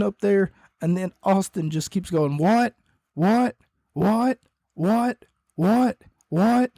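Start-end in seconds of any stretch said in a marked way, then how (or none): 2.4-6.7 repeated taunting whats, pitched higher; mocking broken record. Austin taunting Vince from the Titantron with higher repeated whats. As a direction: needle him like a broken record. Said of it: "Like a broken record".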